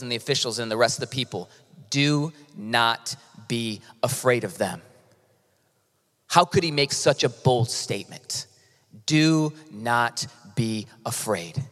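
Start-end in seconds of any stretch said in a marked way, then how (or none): 1.9-4.8 very slow, each word set apart; slow solemn charge. Acted. Leader to the nobles and people, slow solemn charge. 9.1-11.6 very slow, lower, word by word; slow solemn charge. Acted. Preacher repeating the bold statement, slow solemn charge.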